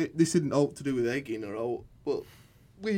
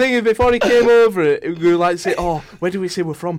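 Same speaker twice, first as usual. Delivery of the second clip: excited; 6 semitones higher, 6 dB louder than usual. Real, not acted. Excited retelling, raised voice.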